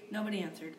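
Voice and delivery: usual speaking voice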